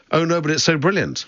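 high-pitched imitation of gushing fans; mock enthusiasm in a high voice. Mimicking the film's admiring fans.

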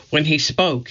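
usual speaking voice

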